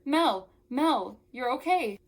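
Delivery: loud, high cries of relief; joyful exclamation. A narrator's reading. Wanda finds her sister Mel okay, excited loud relief. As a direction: call out a name, overjoyed.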